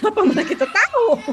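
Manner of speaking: bicara sambil tertawa, nada tinggi. Real, not acted. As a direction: tertawakan kebingungan itu. Tertawa saat bercerita. (sambil tertawa geli)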